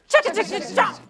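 chugging noise. Shown as a demonstration. Eating noise in story.

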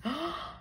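sharp whispered gasp of shock. Acted. Driving mum sees the crossing, sharp whispered gasp. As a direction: gasp in horror. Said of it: "she's going".

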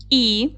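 "І" as a lone vowel; "І" held briefly, cleanly separated; isolated sound. Shown as a demonstration. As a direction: sound out "І" by itself. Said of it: "виконаємо звуковий аналіз слова".

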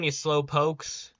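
ordinary delivery

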